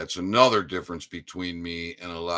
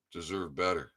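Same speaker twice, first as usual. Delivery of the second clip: soft, low-key "deserve better."; quiet; 3 semitones lower, 8 dB quieter than usual. Real, not acted. Echoing his shout to brothers and sisters, much softer.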